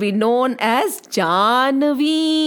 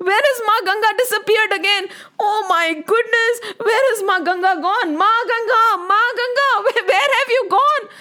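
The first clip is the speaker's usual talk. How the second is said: high, tearful cry of alarm; panicked crying out; 9 semitones higher than usual. Acted. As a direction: call out for her in distress. Bhagirath panics, Ganga vanished.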